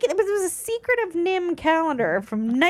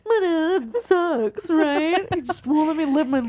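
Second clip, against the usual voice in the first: mock sulky teen, dragged out slow; pouting teenager; 4 semitones higher than usual. Complaining to the boyfriend on the phone, mock pouting teen. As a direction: whine like a grounded teenager. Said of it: "I'm pouting in my room".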